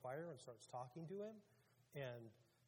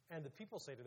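ordinary talk, nothing marked

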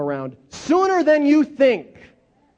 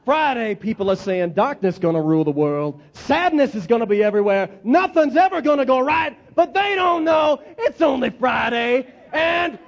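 preaching at full force; 5 semitones higher than usual. Shown as a demonstration. Leading the congregation in call-and-response preaching, rising to a shout. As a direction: climb and roar the last line. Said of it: "Let's do it one more time".

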